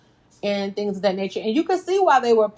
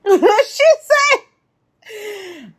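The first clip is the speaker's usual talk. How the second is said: loud burst of laughter; laughing hard; 13 semitones higher, 6 dB louder than usual. Real, not acted. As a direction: crack up loudly mid-sentence. Laughing at Nikki's answer.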